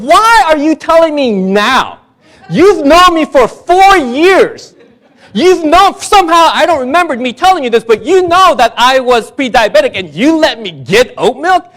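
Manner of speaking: hammed-up indignant shouting at a friend; retold outrage.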